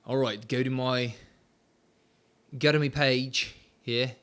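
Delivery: lightly put-on Australian accent; a slipping, half-hearted Aussie accent; loose Australian accent. Streamer navigating to SmackDown, lightly mock Australian accent. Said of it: "doing an Australian accent".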